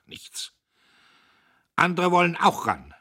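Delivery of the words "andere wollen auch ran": loud, higher defiant call; loud defiant retort to mother. A narrator's reading. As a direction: fling it out loud. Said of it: "rief er".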